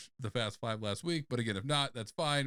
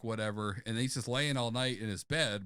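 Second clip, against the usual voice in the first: usual speaking voice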